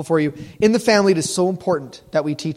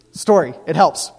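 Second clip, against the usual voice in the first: ordinary talk, nothing marked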